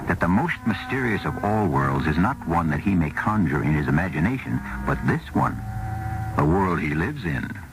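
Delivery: usual speaking voice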